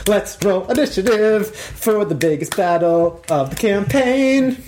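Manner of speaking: high, drawn-out announcement; grand announcer. Pretend. Game master calling players to the biggest battle, grand hyped announcing. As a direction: build it up like a main-event call.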